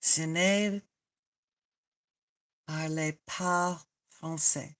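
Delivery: "parle pas français" slow and soft, word by word; sounding it out. Shown as a demonstration. Trying out French for a viewer, slow and tentative. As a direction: feel out "parle pas français" slowly, with gaps. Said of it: "how you say it?".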